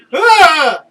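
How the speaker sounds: a sudden loud startled yell. Retold or imitated. Coworker spotting mice, a loud startled yell. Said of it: "this is what he does".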